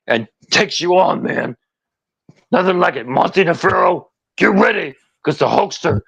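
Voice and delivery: high, hyped wrestling promo voice; pro-wrestler hype, dropping on the closing words; Hulk Hogan impression. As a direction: punch out short phrases like a ring challenge. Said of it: "Can you do Hogan?".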